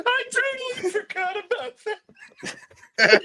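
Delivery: very high voice through laughter; real helpless laughing speech; laughing hard. Real, not acted. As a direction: gasp it out while cracking up. Still cracking up at the joke.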